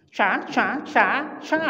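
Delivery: "cha" repeated, each with a different tone contour; Chinese tone drill. Shown as a demonstration. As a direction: drill "cha" through its changing Chinese tones. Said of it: "Itu bahasa Cina". ("cha" diulang dengan intonasi Cina yang berbeda-beda)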